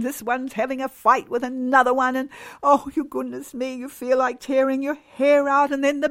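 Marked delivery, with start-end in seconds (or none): none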